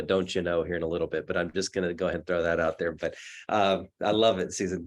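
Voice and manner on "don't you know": normal talking voice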